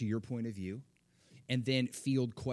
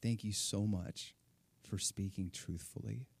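soft thanks; 4 dB quieter than usual. Retold or imitated. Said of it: "she was like".

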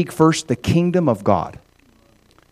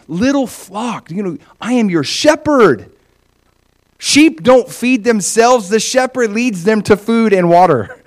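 higher, slow and gentle shepherd's voice; tender shepherd; 8 semitones higher than usual. Acted. Shepherd speaking gently to his little flock, higher and slow. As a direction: reassure the sheep, slow and kind. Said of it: "can you hear the tenderness in Jesus' voice".

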